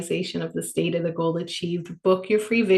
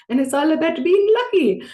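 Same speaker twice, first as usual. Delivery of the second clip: put-on playful high Irish accent, a bit louder; 8 semitones higher, 4 dB louder than usual. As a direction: toss off the line in a sing-song Irish accent. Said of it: "that was my Irish accent".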